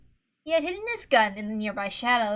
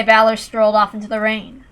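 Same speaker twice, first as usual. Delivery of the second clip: ordinary delivery, nothing marked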